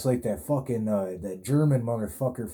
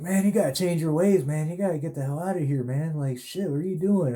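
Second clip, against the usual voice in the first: hammed-up scolding guard, the middle rushed; imitating a guard giving advice, raised and pushy; lecturing guard; 7 semitones higher than usual. Guard lectures him to change.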